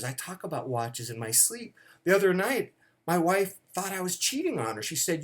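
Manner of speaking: ordinary delivery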